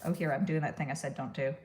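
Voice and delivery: usual speaking voice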